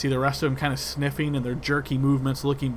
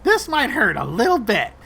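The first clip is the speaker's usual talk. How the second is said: higher, louder sweet menace; smiling rat threat; 9 semitones higher, 7 dB louder than usual. Acted. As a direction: warn them with a grin. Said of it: "smiles once again and says".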